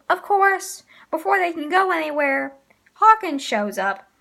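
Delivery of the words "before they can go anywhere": ordinary delivery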